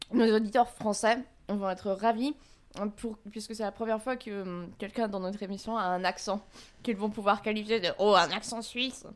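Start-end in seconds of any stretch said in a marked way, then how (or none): none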